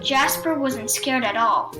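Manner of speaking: usual speaking voice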